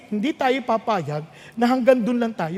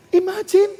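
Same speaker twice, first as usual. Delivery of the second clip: ordinary talk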